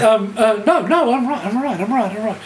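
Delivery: hurriedly insist, repeating yourself nervously; mock flustered. Stoned interviewer reassuring the musician, mock flustered. Retold flustered reply to [name].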